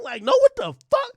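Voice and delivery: a shrieking what the fuck; comic high disbelief; shocked squeal. Real, not acted. Disbelief at being left out.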